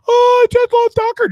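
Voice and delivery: high squealy mock fan voice; gushing fan. Mocking fans who adore his dog, high gushing squeal.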